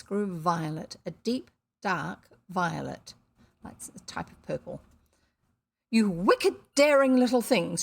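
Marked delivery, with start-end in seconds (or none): 5.9-7.8 put-on loud, furious scold at the children; angry cry. A narrator's reading. Missus Biddle shouts at the children in anger. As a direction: spit it out at the intruding kids. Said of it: "she cried".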